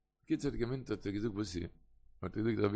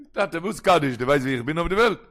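raised voice, pushing back at a father; louder, argumentative retort; protesting son; 3 semitones higher, 9 dB louder than usual. Acted. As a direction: voice an indignant protest to Tate.

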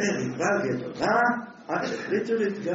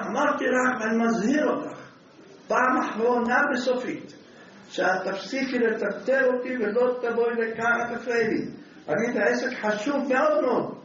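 higher, raised warning voice, lightly hammed; mock angry shout; 6 semitones higher than usual. Husband warns his wife to stop bothering him, mock angry shout. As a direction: bark a final warning, playfully. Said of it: "צעק עליו".

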